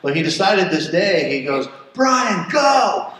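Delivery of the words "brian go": high, loud shout of go; sudden yell. Retold or imitated. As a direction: yell him onto the wave. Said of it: "never has he yelled".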